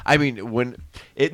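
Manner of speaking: ordinary talk, nothing marked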